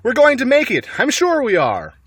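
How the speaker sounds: high, loud burst of hope; hopeful excitement. A narrator's reading. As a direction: cheer them on, sure of escape. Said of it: "Mister Fox would say".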